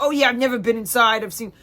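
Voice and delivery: imitating the rambling Uber driver; odd Uber driver voice. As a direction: do the driver as she bragged. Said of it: "She's like".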